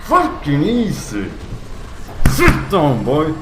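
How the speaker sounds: loud, cocky trash talk at a beaten foe; loud triumphant taunt; cocky gloating. Real, not acted. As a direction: gloat at him like you just won easy. Cocky gloating after beating enemy.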